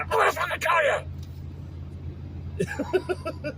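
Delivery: high hammed-up mock fury; over-the-top fake angry threat; mock rage. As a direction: pretend to blow up at the kid. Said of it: "rather than a".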